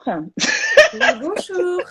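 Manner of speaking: high sugary hostess greeting; sweet phone hostess voice. Retold or imitated. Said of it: "La voix d'hôtesse".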